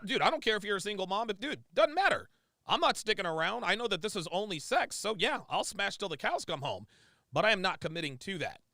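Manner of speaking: ordinary talk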